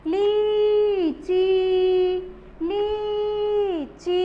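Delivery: लीची very slowly, ली and ची both held out; both vowels long. Shown as a demonstration. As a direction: stretch both ee sounds in लीची. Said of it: "दोनों को लंबा sound लेके बोलना है". (लीची की दोनों ई लंबी खींचकर)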